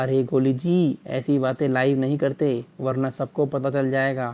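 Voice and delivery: low, softened voice kept off the mic; hushed aside. Acted. Anchor hushing Kohli so viewers don't hear, in a low hush. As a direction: pull it down to a nervous hush.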